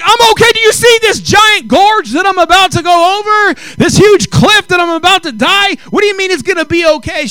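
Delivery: high, loud frantic protest; panicked outburst. Retold or imitated. Pastor panicking at the attendant on the tube, frantic loud protest. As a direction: shout it like you're about to go over a cliff.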